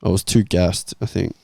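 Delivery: ordinary talk, nothing marked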